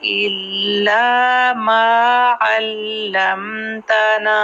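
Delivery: slow tajweed recitation; slow Qur'an reading; Qur'anic recitation. Shown as a demonstration. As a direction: recite each word slowly with long vowels held. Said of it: "तो इतने को फिर से देखते हैं".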